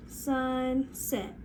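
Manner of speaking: "Sunset" pulled apart as SUN... SET; segmented "Sunset", each syllable held; stretched into syllables. Shown as a demonstration. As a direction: stretch "Sunset" slowly over two beats. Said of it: "let's try it one more time".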